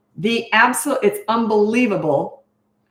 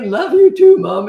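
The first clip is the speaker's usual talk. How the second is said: put-on high, very loud cracking boy's voice; teen son voice; 6 semitones higher, 11 dB louder, slower than usual. Mother imitating her teen son's cracking reply, high and loud. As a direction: voice breaking upward.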